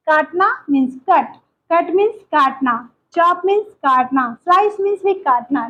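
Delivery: slow, careful word-by-word teacher reading; slow teaching read. Shown as a demonstration. Teacher reading vocabulary slowly to students. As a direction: spell it out slowly for learners. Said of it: "teacher reads the word list".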